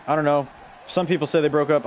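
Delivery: ordinary talk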